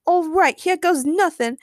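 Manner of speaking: plain reading voice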